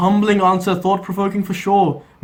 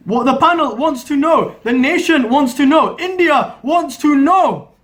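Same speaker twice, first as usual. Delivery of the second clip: dramatic anchor rant; 6 semitones higher than usual. Acted. Anchor's rousing refrain.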